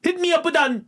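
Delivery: high, loud Jamaican-Londoner hailing someone; punchy, high-pitched mock Jamaican accent. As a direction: call out in hammed-up Jamaican London patois. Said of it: "Jamaican, Jamaican, uh, li-, like, the Jamaican part of London".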